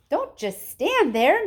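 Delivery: high, loud and slow; wife screaming at her husband. Acted. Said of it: "Missus Gloop screamed at Mister Gloop".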